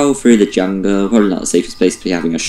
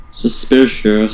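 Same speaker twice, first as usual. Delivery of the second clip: mock suspicious; much slower than usual. Repeats drawn out.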